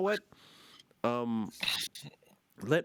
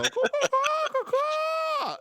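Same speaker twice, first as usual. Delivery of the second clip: loud high bird caws; cawing like a crow; 5 dB louder, much slower than usual. Pretend. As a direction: caw like a rooster crowing. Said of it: "Now I start clucking like a rooster".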